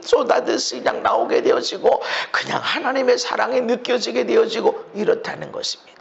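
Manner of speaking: usual speaking voice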